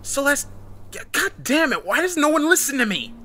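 high, frustrated shout; desperate high-pitched yelling; shouting, begging. A narrator's reading. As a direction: shout up at someone, begging and exasperated. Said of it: "she started shouting, begging her to come down".

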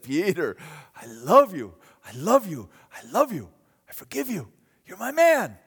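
loud, earnest repeated I love you; tender love. Acted. Jesus forgiving Peter, earnest and loud. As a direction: pour out forgiveness.

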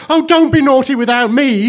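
put-on high, loud childish wail; tearful cry. A narrator's reading. Blue Eyes pleads with the turkey, tearful cry. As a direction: wail as if bursting into tears. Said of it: "burst into tears".

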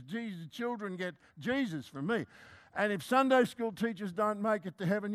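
ordinary delivery

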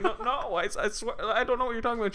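put-on high, flustered old man voice; protesting old man. Accused old man denying it to Mary, high protest.

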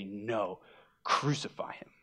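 low, near-whispered retold refusal; quietly voiced, grave quote; hushed demand. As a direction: drop to a whisper for the leaders' verdict. Voicing the leaders' demand.

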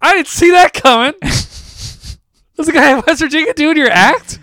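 high and loud, slow disbelief; stunned disbelief. Real, not acted. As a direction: spell it out in amazement. Reacting to the joke-thief news.

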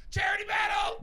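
high, loud game show yell; hammed-up quiz host cry; mock announcer shout. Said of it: "announces a mock game show".

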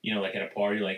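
normal talking voice

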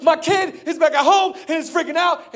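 high, frantic dad; acted frantic plea. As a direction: play a father in a panic. Frantic dad runs up to Jesus.

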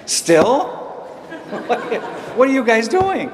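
high, loud laughing disbelief; teen son laughing in shock at parents' news; mock disbelief. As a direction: laugh through a teenager's shock. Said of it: "I'm like".

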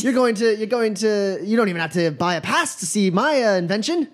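high-pitched huffy magician; indignant inventor trickster. Acted. Magician defending his invention to a customer, high indignation. Game master plays offended magician.

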